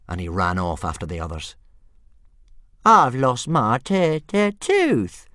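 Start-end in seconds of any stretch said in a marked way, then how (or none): 2.8-5.3 high, slow stammering whine; little dragon whining over his tooth; whiny dragon. A narrator's reading. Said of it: "Toothless complained, whinily".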